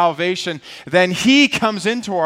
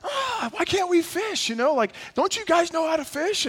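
acted, high-pitched fed-up griping; frustrated whine; 13 semitones higher, 5 dB quieter than usual. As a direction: whine in exaggerated exasperation. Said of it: "I'm just getting frustrated and upset".

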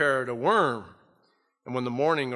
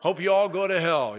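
reluctant preaching; 6 semitones higher, 3 dB louder than usual. Retold or imitated. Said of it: "He's preaching a reluctant message".

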